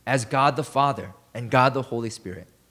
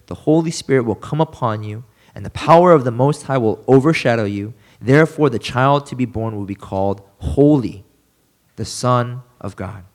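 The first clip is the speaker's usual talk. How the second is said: scripture reading; 2 semitones lower, 5 dB louder than usual. Acted. Pastor reading Luke to his congregation, solemn and louder. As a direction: read it out louder, with a preacher's weight. Reads the angel's answer aloud.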